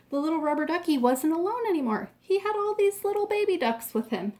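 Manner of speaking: ordinary delivery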